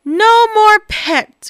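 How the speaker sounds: slow, drawn-out weary groan. Acted. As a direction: groan it, worn out. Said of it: "groaned Bob".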